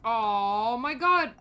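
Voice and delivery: loud, drawn-out oh my God; real groan of frustration, stretched slowly; loud slow exasperation; exasperated outburst. Real, not acted. Exasperated at redoing quest.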